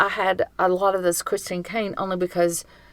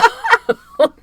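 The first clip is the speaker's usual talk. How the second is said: high loud laughing burst; 7 dB louder than usual. Real, not acted. Cohost cracking up after her joke, high laugh.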